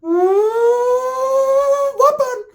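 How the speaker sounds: very high, loud squeal; mock high squeal. Ricky about to beat Pepe, with a very high mock squeal. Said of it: "Ricky be like".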